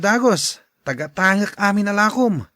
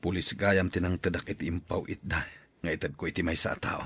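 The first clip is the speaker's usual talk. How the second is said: much lower, subdued put-on voice; low worried man; 13 semitones lower, 9 dB quieter than usual. Man telling his wife police questioned him, low and worried. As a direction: speak low and uneasy about the police.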